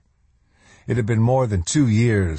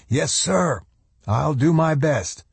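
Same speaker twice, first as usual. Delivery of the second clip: put-on higher, brisk young soldier voice; eager lieutenant; 8 semitones higher than usual. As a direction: report dutifully to the colonel.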